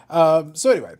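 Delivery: ordinary delivery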